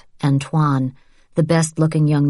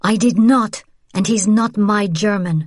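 higher, louder, stung denial; indignant denial; 5 semitones higher, 3 dB louder than usual. A narrator's reading. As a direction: snap back in defence. Accused of favor with German.

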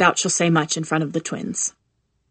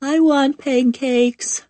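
put-on slow, high, half-asleep little girl; drowsy child barely awake; sleepy toddler; 8 semitones higher, much slower than usual. Sleepy twin asking.

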